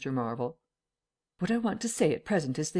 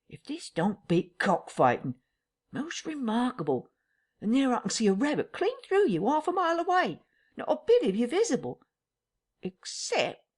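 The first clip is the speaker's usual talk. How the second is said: higher, wondering tramp voice; amazed rustic tramp; 9 semitones higher than usual. Acted. Tramp Marvel feels the invisible man, higher and amazed.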